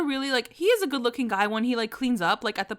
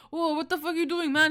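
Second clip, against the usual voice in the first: mock yell; 6 semitones higher than usual. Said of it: "He's, like, yelling at him".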